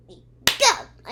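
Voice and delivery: sudden high, loud cry; excited shout. Real, not acted. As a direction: fire it off like a starting gun. Excited cheer moving on.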